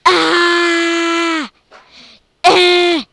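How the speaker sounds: loud angry yell; loud frustrated yell; shout. Real, not acted. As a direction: scream at the screen. Screaming at the game.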